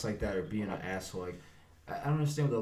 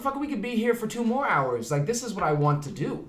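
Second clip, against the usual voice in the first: loud, much higher excited outburst; eager yes; 12 semitones higher, 7 dB louder than usual. Retold or imitated. Director eagerly agreeing to stay late for another shot. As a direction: burst out gladly, no complaint.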